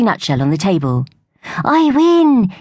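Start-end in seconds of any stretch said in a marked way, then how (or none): 1.6-2.5 high triumphant cheer. A narrator's reading. Young squirrel cheering his race win. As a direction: crow a win, higher and louder. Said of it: "he cheered".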